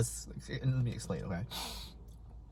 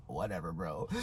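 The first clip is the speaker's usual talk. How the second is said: low, soft, dismissive; imitating an uncaring teacher; mock indifference; 8 semitones lower, 10 dB quieter than usual. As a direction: shrug it off in a lowered voice. Teacher who does not care.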